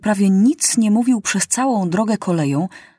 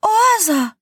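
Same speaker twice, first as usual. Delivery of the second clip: sudden, much higher cry of "Oaza!"; excited shout; 12 semitones higher, 4 dB louder than usual. A narrator's reading. Maciuś spots the oasis through the telescope, sudden high excited shout. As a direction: shout it out the moment you spot it. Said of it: "krzyknął nagle Maciuś".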